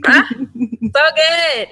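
slow, laughing sigh of delight. Real, not acted. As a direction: laugh while savoring it. Savoring remembered food.

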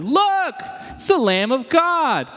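high, drawn-out excited call; exclaiming. Retold or imitated. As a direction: point him out with wonder. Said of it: "and said".

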